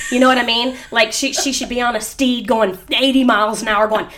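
normal talking voice

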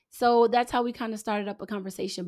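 ordinary talk, nothing marked

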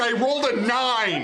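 thrilled, laughing; gleeful and proud; excited laughing. Real, not acted. Celebrating the roll.

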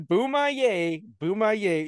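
high, slow "Boo my yay" teasing; mocking imitation. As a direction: parrot a friend's muddy "Boom I A" back to him.

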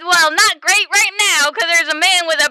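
high-pitched, mildly panicked report; acted alarm. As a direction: play it rattled, calling for help. Acted emergency call amid villains.

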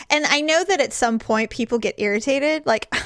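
usual speaking voice

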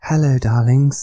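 loud, slow camp lady greeting; woman's voice. Pretend. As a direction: put on a theatrical woman's voice. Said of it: "Just try and do a woman's voice".

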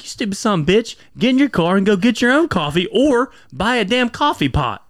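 high, loud exasperated rant; angry rant. Retold or imitated. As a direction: yell it at lazy coffee orderers. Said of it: "And I'm like".

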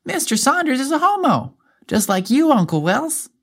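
put-on a little girl innocently blurting it out. A narrator's reading. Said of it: "Emily instantly answered".

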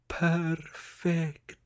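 soft, slow, drawn out; whispered. A narrator's reading. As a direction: breathe it in delight. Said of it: "she whispered".

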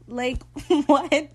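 laughing, high and loud; laughing disbelief. Real, not acted. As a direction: laugh out a baffled what. Reacting to absurd line.

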